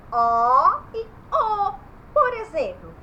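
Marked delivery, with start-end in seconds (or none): 0.1-1.7 loud, slow contrast of the two "O" vowels; two O sounds. Shown as a demonstration. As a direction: open "O", then closed "O". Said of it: "Dois sonzinhos".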